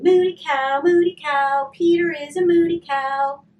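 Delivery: loud, high singsong taunt; taunting shout. A narrator's reading. Little sister taunting her brother Peter, loud mocking shout. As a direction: jeer it at your brother, full voice. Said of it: "She started shouting".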